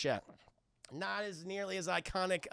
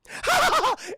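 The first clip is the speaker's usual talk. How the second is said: high, loud burst of laughter; sudden cackle at own impression; 10 dB louder than usual. Real, not acted. Streamer cracks up at Sarah's act, high loud laugh. Bursts out after mock-sincere quote.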